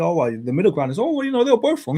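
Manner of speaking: high-pitched mock waffling; mocking quote. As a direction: imitate a wishy-washy fence-sitter. Voices middle-ground opinion to mock it.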